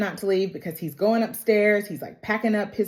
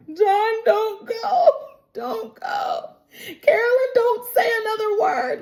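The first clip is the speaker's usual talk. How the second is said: high-pitched wailing, hammed-up sobs; mock weeping. Viewer weeping along with the episode, hammed-up high wail. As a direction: wail it way up high, pretend crying. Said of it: "the way I was weeping during this episode".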